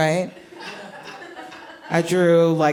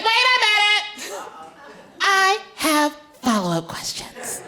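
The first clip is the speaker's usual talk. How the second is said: put-on high little-girl interruption; 1 insistent little girl; 11 semitones higher than usual. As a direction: hold up like a tiny lawyer. Daughter voiced.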